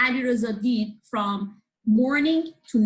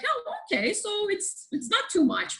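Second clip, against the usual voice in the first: casual shrug; 12 semitones higher than usual. Retold or imitated. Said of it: "to me it might be like".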